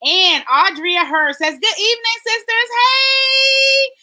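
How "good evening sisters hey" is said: high singsong hello; gushing, pitched-up welcome; excited greeting. Real, not acted. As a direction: sing out the hey, long and high. Greeting viewers from the live comments.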